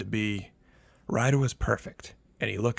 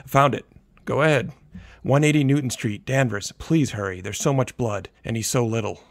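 frantic pleading; 3 semitones higher, 7 dB louder than usual. A narrator's reading. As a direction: beg them to hurry. Panicked mother giving address.

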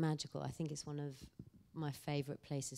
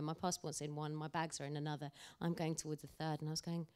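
ordinary talk

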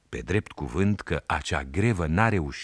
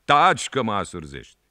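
annoyed policeman; 5 semitones higher, 7 dB louder than usual. A narrator's reading. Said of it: "a zis polițistul, mai supărat".

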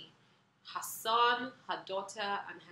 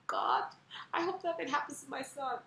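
a touch of a desperate plea to God, higher and louder; mild anguished cry to God, retold; retold tearful prayer; 7 semitones higher, 6 dB louder than usual. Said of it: "I was crying".

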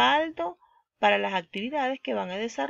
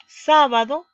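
"sábado" with a loud, higher SÁ; stress on SÁ; 5 semitones higher, 10 dB louder, slower than usual. Shown as a demonstration. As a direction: punch the first syllable of "sábado". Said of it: "la mayor fuerza de voz está en sa".

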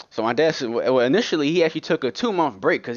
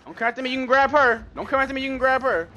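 panicked pleading; 11 semitones higher, faster than usual. Real, not acted. Begging enemy to chase other.

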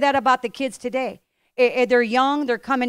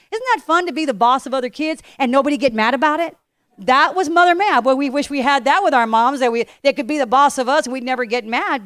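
rising, louder preacher's call to the room; fired-up sermon voice; preaching; 2 semitones higher, 6 dB louder than usual. Acted. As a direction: preach it out, building up. Pastor preaching to congregation.

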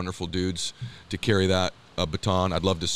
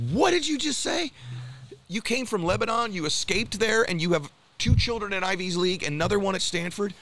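high, astonished retold exclamation, voice jumping up; amazed disbelief; 11 semitones higher than usual. Telling the host about the Uber driver, astonished high exclamation. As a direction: recreate your jaw-dropped reaction.

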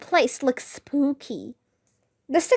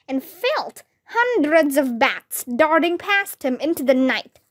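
plain reading voice

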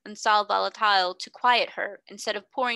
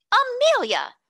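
shrill, loud outraged cry of the name; infuriated gasp; 8 dB louder, slower than usual. A narrator's reading. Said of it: "gasped her infuriated elder".

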